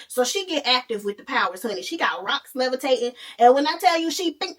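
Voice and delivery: normal talking voice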